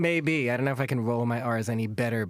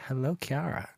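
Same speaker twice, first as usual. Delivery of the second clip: greeting with a slight Germanic accent; 4 dB quieter than usual. Real, not acted. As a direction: attempt Italian, let it come out German. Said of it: "said my name in this sort of Germanic accent".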